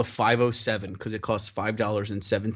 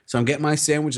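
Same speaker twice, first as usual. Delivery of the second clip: normal talking voice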